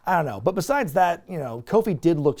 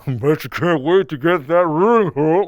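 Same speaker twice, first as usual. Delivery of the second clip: muffled Santa; 7 dB louder than usual. Retold or imitated. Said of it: "talking through his muffled beard".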